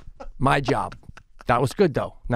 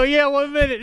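high, polite "Yeah, one minute"; mock-sweet "one minute" to a fan; polite brush-off; 11 semitones higher, 3 dB louder than usual. Imitating him stalling a fan politely: high and sweet. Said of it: "and very politely, you go".

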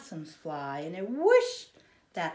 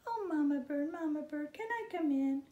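high small pleading voice; baby bird begging to come home; little bird pleading; 9 semitones higher, faster than usual. Acted. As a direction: ask mama sweetly.

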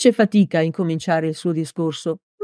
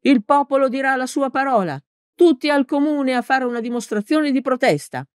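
high-pitched call to a crowd; rally the crowd, voice pitched well up; narrated shout without much added volume; rallying yell; 8 semitones higher than usual. A narrator's reading. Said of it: "e urlò".

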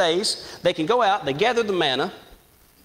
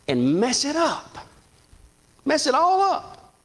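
slow, high and drawn out; rising preacher intensity; 9 semitones higher, slower than usual. Real, not acted. Preacher to his church, slow and high. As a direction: pull each word out higher, preaching to the congregation. Preacher dramatizing Satan's ruin.

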